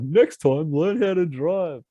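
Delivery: high, slow mocking echo; mocking quote. Friends mocking his tirade, high drawn-out mockery. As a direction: lampoon the line the way friends tease. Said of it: "my friends make fun of me".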